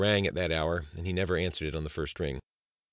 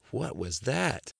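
slow, higher-pitched disbelief; startled question; 6 semitones higher, much slower than usual. A narrator's reading. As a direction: draw out each word in alarm, pitch raised. Startled by explosion.

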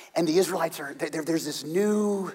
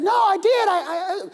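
very high, loud sputtering protest; protesting little boy; 6 dB louder than usual. Acted. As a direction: insist like an indignant kid. David protesting to brother.

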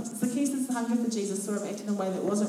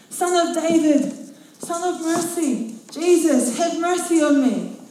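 higher, louder desperate plea; a blind beggar begging Jesus for mercy; crying out; 8 semitones higher, 7 dB louder, slower than usual. A narrator's reading. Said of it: "he began to cry out".

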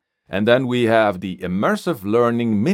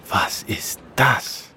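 whispering on the phone; 5 semitones higher, slower than usual. Acted. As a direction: breathe it secretly. Paul spying, whispering.

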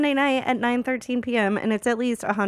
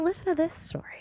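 whisper; 6 semitones higher, 5 dB quieter than usual. Acted. Whispered aside to listener.

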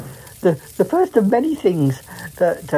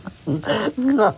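bursting into giggles mid-scene; giggle, breaking the scene; breaking character giggling; 5 semitones higher than usual. Real, not acted. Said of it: "I've corpsed".

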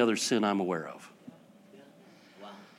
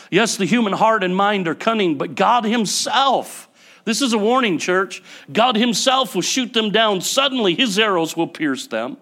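high, loud, driving sermon voice; fiery preaching; 8 semitones higher, 5 dB louder than usual. Acted. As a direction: preach it hard to the church. Warning the church from scripture.

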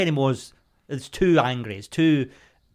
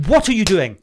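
exasperated snap; 5 semitones higher, 4 dB louder than usual. Retold or imitated. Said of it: "I'm like".

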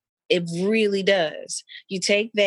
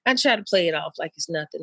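ordinary talk

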